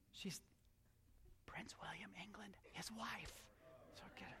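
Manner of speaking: hushed, whispered prompt; whispered aside. Real, not acted. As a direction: whisper it as a helpful hint. Whispered prompt about who she is.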